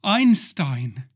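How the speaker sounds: solemn visitor from another dimension, growing urgent; lightly voiced alien messenger. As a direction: warn the people of Earth before you explode.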